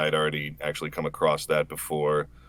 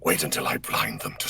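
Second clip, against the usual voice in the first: low, unvoiced whispery villain line; Omen voice; 7 semitones lower, 5 dB quieter than usual. Voice actor redoing his game line for fans, low whispered menace. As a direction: breathe it out like a shadowy assassin. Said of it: "He nails it every time".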